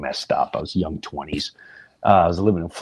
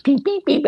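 high, even electronic beeps; microwave beeps; 3 dB louder than usual. Retold or imitated. Son microwaving burgers late at night, imitated beeps.

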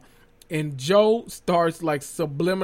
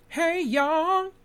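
high, soft, slow mock greeting; put-on high voice; 9 semitones higher, 3 dB quieter, much slower than usual. Clowning a fake intro to viewers, high soft put-on voice. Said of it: "Now, let me stop".

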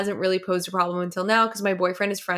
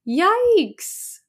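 surprised yikes; 11 semitones higher than usual. Real, not acted. Reacting to awkward lecture story.

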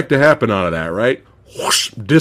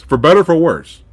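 ordinary delivery

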